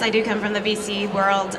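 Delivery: usual speaking voice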